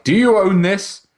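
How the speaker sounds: high, loud, slow mock-official question. Mocking YouTube's review asking about his own video. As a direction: ape a suspicious YouTube reviewer. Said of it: "they're like".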